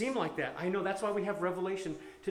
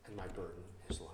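soft tender reading; 5 semitones lower, 6 dB quieter than usual. Acted. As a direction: read it softly, like comfort. Reading Jesus' words softly.

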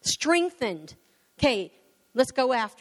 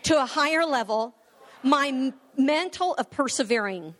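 bold, slow, firm proclamation; emphatic declaration prayer; 3 semitones higher than usual. Acted. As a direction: declare each phrase for the church to repeat. Leads declaration prayer aloud.

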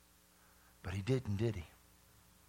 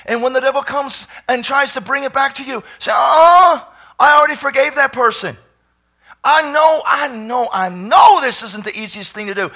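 louder, higher shouted preaching; preaching at full force; 9 semitones higher, 13 dB louder than usual. Acted. Preacher urging the congregation to forgive, full-force shouted preaching. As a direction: drive the exhortation home to the congregation.

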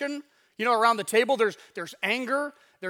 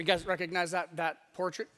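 ordinary talk, nothing marked

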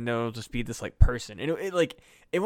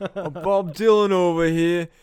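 slow, loud, higher mock [name] drawl; [name] impression; 6 semitones higher, 9 dB louder than usual. Joking that the brain talks like [name], in a slow loud mock Dylan voice. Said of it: "like fucking [name] in, like, Boston".

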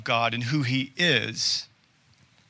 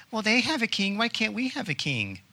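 whiny Israelites; 7 semitones higher, 5 dB quieter than usual. Acted. Israelites whine for a king.

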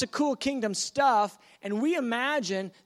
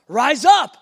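loud call; 3 semitones higher, 13 dB louder than usual. Acted. Jesus calls again, excited.

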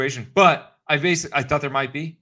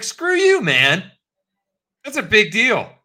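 much higher, louder indignant yell; hammed-up outrage; mock angry retort; 10 semitones higher, 6 dB louder, slower than usual. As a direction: fling it back furiously.

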